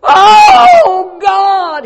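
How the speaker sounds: anguished wailing shout of Oh God; wailing cry. Retold or imitated. Grieving man wailing at the church bench, anguished shout. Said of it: "he was going".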